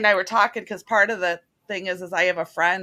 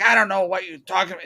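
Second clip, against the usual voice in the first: lightly imitated Southern fisherman; a rough stab at his Southern accent; 3 dB louder, faster than usual. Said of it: "he's got a Southern accent".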